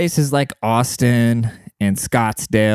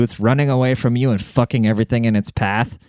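ordinary delivery

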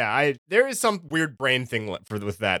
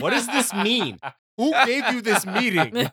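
mock baffled executive; 4 semitones higher, slower than usual. Voicing baffled studio executive.

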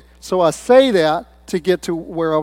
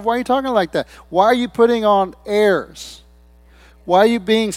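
incredulous questioning; 5 semitones higher, 4 dB louder than usual. Retold or imitated. Retold puzzled questioning of churchgoers' airs.

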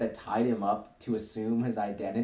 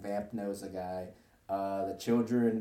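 normal talking voice

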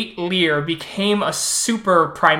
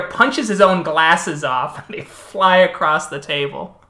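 ordinary talk

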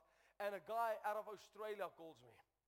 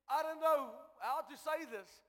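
raised, loud disbelieving report; hammed-up bewilderment, building in volume; astonished; 5 semitones higher, 6 dB louder than usual. Crying estate agent's astonished report.

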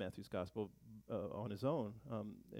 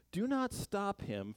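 quoting Jesus; 9 semitones higher, slower than usual. Retold or imitated. Jesus quoted in reading.

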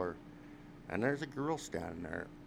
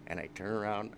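ordinary delivery

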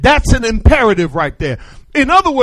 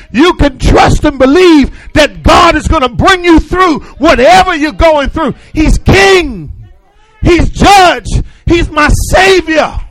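fiery preaching; 4 semitones higher than usual. Acted. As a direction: preach it out to the congregation. Preacher at full force.

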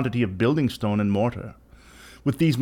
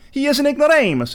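much higher, louder cut-in; interrupting Fortunato; 14 semitones higher, 4 dB louder than usual. A narrator's reading. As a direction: barge in high and scornful. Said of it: "interrupted my friend".

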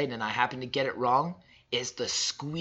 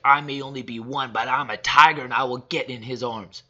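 put-on louder, bold toddler declaring her claim; fierce little tiger; 9 dB louder than usual. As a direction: roar it like a tiny determined tiger. Toddler daughter voiced, fierce claim.

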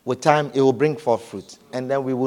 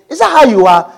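mock question; 8 semitones higher, 9 dB louder than usual. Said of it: "and we say".

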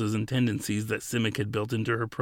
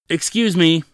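loud, high-pitched interruption; blurted out; 7 semitones higher, 9 dB louder than usual. A narrator's reading. Woman striding up to an attendant's stall, blurted out loudly. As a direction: blurt it out to grab someone's attention. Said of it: "she blurted out at the man".

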